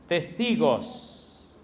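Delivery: one slow weighty word; drawn-out emphasis. Real, not acted. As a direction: stretch the word Testigos very slowly. Preacher stresses key word.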